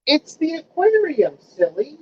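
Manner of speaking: high, slow teasing coo; starting high, dropping on the last word; pet talk. Real, not acted. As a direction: tease a pet fondly, high and drawn out. Talking to a pet.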